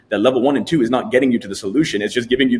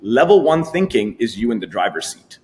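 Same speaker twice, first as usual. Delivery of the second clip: usual speaking voice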